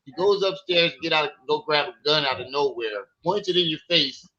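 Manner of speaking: usual speaking voice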